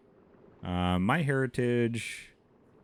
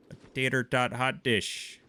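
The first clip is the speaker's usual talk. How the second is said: a light, higher Minnesotan lilt on tater tot hot dish; Minnesotan accent; 5 semitones higher than usual. Pretend. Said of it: "Midwestern delicacy known as".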